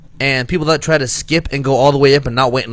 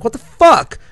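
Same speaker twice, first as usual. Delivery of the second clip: higher, louder yell; yelling at a driver who tried to cut in; retold yell; 10 semitones higher, 7 dB louder, slower than usual. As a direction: shout it out the car window. Yelling out car window.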